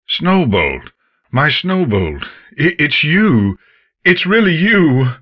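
joy swelling to a loud, high shout; overjoyed, building cry. Acted. Father recognising his lost daughter, rising to an overjoyed shout. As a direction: build from disbelief to a joyous cry.

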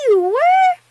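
high, loud squeal of astonishment; incredulous child voice; disbelief. A narrator's reading. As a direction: squeak it like an amazed little girl. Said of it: "she asked in disbelief".

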